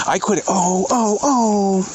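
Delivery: high, strained cries of dismay; genuinely shaken, crying out; real distress. Real, not acted. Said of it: "hang on, I'm having a moment".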